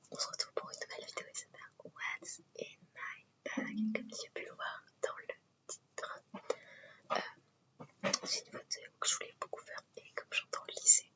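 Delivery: chatty ASMR whisper to viewers. Real, not acted. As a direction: whisper close to the mic, intimate and easy. ASMR whisper vlog throughout.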